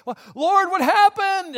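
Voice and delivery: high, loud wail of bewilderment; distressed cry to God. Acted. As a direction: cry out to the Lord in acted alarm. Acting out a panicked prayer.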